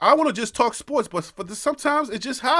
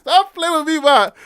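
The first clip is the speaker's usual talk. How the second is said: put-on high, shouted Liberian accent breaking into laughter; mock-scold loudly in Liberian pidgin, cracking up; laughing Liberian-accented shout; Liberian big-boy accent; 7 semitones higher, 7 dB louder than usual. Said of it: "when you did the small boy, big boy accent".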